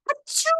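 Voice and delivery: high, explosive achoo; big sneeze. Acted. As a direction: let the sneeze burst out. Wolf sneezes.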